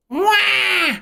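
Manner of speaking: high-pitched mock wail; exaggerated crybaby "wah"; imitated kid crying. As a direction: bawl like a little kid. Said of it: "crying her eyes out".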